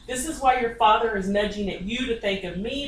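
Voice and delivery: normal talking voice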